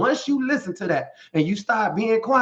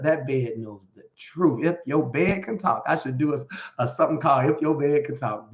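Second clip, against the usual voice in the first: usual speaking voice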